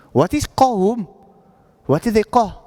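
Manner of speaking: usual speaking voice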